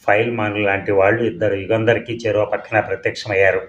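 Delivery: usual speaking voice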